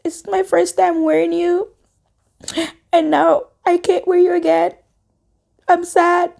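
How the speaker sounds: high, whimpering mock-crying voice; retold crying, small high halting voice; tearful farewell. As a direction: whimper a tender goodbye, pitched high. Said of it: "And I was crying".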